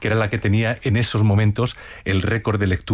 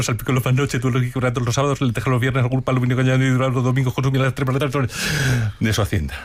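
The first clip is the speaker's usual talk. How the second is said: fast, even monotone rattle, words running together; rapid-fire reading. Shown as a demonstration. Presenter attempts the challenge with a famous text, reading at top speed. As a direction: race through the text at breakneck speed. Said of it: "tengo un reto también para ti".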